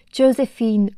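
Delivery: Josephine in English accent, "Jo-se-feen" with English vowels. Shown as a demonstration. Teacher mimicking how the film's actors say Josephine, English accent. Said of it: "avec un accent anglais".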